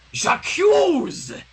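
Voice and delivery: loud, high theatrical j'accuse; mock accusation. Reader breaks in with a hammed-up loud accusation. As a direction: fling it like a courtroom drama.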